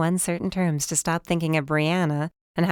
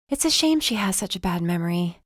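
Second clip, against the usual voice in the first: usual speaking voice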